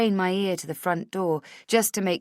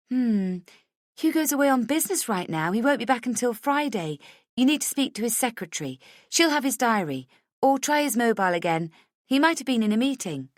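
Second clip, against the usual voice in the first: higher, bright and friendly; bright friendly hello; 7 semitones higher than usual. A narrator's reading. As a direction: answer the phone sunnily. Heather explains helpfully.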